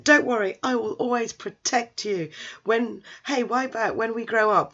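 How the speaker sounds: lightly voiced plucky kid; little boy hero. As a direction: promise like a small playground protector. Little boy hero promises protection.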